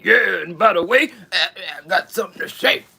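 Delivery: loud grumpy husband shouting; ornery husband shouting back; grumpy old man. Pretend.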